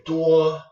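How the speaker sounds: "duo" held high and level; first tone. Shown as a demonstration. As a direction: say "duo" on a high, flat, sustained pitch. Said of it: "a first tone".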